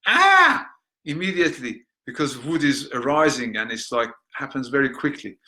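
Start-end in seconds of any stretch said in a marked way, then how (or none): 0.1-0.7 sudden high sharp cry; triggered outburst. Shown as a demonstration. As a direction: burst like a sudden flare of anger. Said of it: "like you get triggered".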